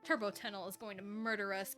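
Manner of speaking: usual speaking voice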